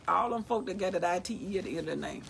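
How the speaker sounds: ordinary talk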